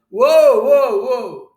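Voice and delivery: very loud, high calls to stop; urgent shout. A narrator's reading. Mister Shears stepping into the parents' row, yelling. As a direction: yell to break up a fight. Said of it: "And Mister Shears shouted".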